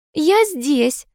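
high, sing-song coy voice; coquettish. A narrator's reading. Niece presenting herself to the suitor, coquettish. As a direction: coo it flirtatiously, pitched right up. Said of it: "кокетливо произнесла девица-красавица".

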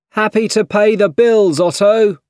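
high, loud cheerful-sized call across a room. A narrator's reading. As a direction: call it out to someone at a distance. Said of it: "called Harry".

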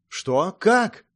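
high, loud burst of disbelief; astonished, rising cry; startled exclamation. A narrator's reading. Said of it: "воскликнула Мэй".

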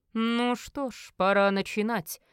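slow, drawn-out villain's voice; drawled. Acted. Merlin about to start the ritual, slow drawl. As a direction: stretch the words out with relish. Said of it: "протянул он".

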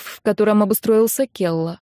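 ordinary delivery, nothing marked

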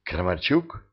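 low, soft, tentative question; half-whisper. A narrator's reading. Maria checking she recognises the sergeant, half-whispering. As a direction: ask it under your breath. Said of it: "всё ещё полушёпотом проговорила она".

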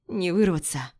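usual speaking voice

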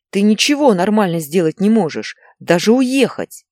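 high, loud reproach; shouting. A narrator's reading. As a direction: shout accusingly at your wife. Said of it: "кричал он Инне". (муж кричит с упрёком)

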